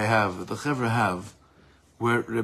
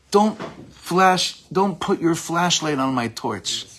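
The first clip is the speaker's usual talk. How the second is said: raised, forceful imitation of a scolding; retold scream; 5 semitones higher, 3 dB louder, slower than usual. Teacher quoting the rabbi warning off the infiltrator, mock yell. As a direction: act out an outraged warning, pitched high. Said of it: "screamed at him".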